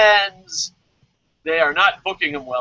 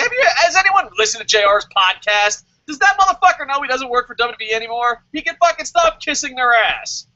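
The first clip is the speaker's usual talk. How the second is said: higher, heated complaining; exasperated rant; 7 semitones higher, 3 dB louder than usual. Real, not acted. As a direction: rant in real exasperation, voice climbing. Rant about J R's podcast.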